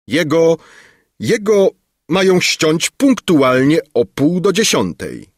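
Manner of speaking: high, loud, alarmed report; agitated stammering news. A narrator's reading. Minister telling the king of an execution, agitated. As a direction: blurt it out in broken urgency.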